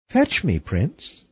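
cartoon princess. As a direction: tease like a bold princess. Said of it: "princess replies".

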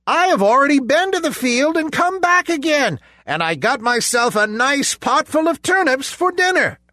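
high, fast smug pig; little pig voice. A narrator's reading. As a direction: brag quickly and cheekily. Pig boasts to wolf.

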